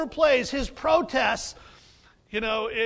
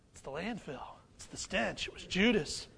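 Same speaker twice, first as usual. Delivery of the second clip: hushed reveal; 3 semitones lower, 7 dB quieter than usual. Real, not acted.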